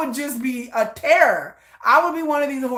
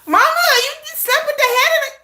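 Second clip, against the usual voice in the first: high, loud scolding; exasperated son; 5 dB louder, faster than usual. Retold or imitated. Son scolding his mother, high and loud. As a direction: scold mom in disbelief.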